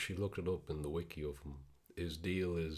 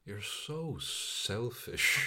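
slow, drawn-out sulky brother; mock older brother; 6 semitones higher, 4 dB louder, much slower than usual. Older brother scolding his younger brother, slow and sulky. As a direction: drag it out like the whiny older brother. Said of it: "the older brother just goes".

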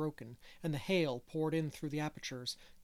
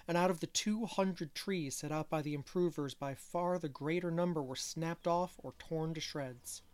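ordinary delivery

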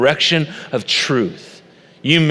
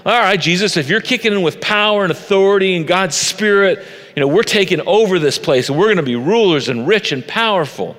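higher-pitched, eager acted enthusiasm; excited followers; 6 semitones higher than usual. Jesus' followers expecting to take over, acted excitement. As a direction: play the followers pumped up, pitch raised. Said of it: "they had to be a little bit excited about".

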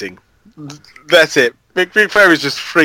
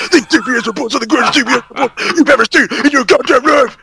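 promo shout; 9 semitones higher, faster than usual. Acted. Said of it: "cutting a wrestling promo".

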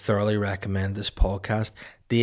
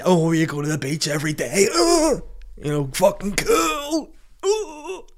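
mocking voice; 12 semitones higher, 4 dB louder than usual. Mocking sea swimmers.

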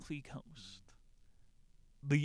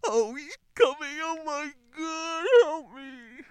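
hammed-up high loud fright; mock panic; 13 dB louder, slower than usual. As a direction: shriek like a horror victim. Pretends Jason is behind him.

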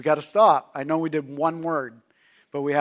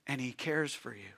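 ordinary talk, nothing marked

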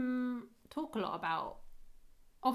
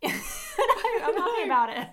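higher, louder words through real laughter; helpless giggling; 12 semitones higher, 9 dB louder, much faster than usual. Real, not acted. Host losing the thread of the recap, laughing helplessly. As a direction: try to talk through a giggling fit.

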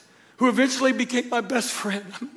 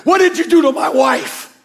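loud, high, furious shout down the phone; retold shout from a worried husband; angry husband shouting; 6 semitones higher, 8 dB louder than usual. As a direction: yell it like a frantic husband.

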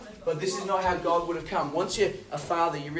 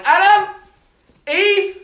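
much higher, much louder angry call of the names; angry parent; 17 dB louder, slower than usual. Retold or imitated. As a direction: bellow the names like a furious parent. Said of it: "like a parent that's angry".

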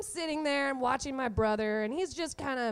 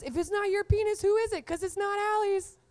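raised, pointed mom accusation; mom accusing; 8 semitones higher, 3 dB louder than usual. Retold or imitated. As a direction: corner the brother like a suspicious mom. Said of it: "And she goes".

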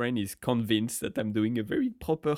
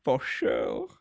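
put-on failed Valley Girl; 4 dB louder, much slower than usual. Shown as a demonstration. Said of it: "Can you do a Valley Girl?".

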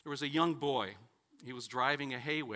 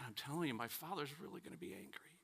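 put-on hushed worried boy; 10 dB quieter, faster than usual. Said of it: "the boy says".

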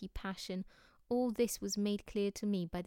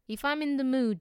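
louder, higher, laying down a firm condition; raised voice, setting terms; insistent condition; 5 semitones higher, 8 dB louder than usual. A narrator's reading. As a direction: insist on it forcefully. Zorba insisting on his one condition.

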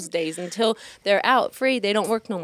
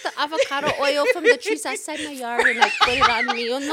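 imitating Fraulio; 5 semitones higher than usual. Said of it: "He goes".

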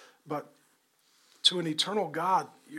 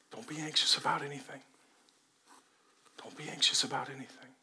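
hushed, tender and much softer; loving whisper; 3 semitones lower, 8 dB quieter, faster than usual. A narrator's reading. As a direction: whisper it like a father holding a child. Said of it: "looks at you with love".

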